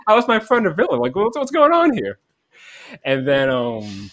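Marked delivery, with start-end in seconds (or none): 0.1-2.2 much higher, baffled and incredulous; shocked disbelief. Retold or imitated. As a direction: voice jumping up in disbelief that his friend is a villain. Confused shock at friend called villain.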